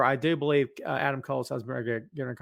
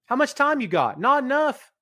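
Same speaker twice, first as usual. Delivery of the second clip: playful exclaim; 10 semitones higher, 5 dB louder than usual. Real, not acted. Joking exclamation.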